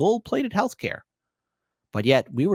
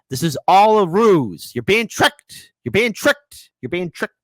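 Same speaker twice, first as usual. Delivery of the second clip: louder, pressing, slow; urgent warning; 5 semitones higher, 5 dB louder, slower than usual. Real, not acted. Host warning viewers that they are being tricked, loud and urgent. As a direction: hammer the warning home to the audience.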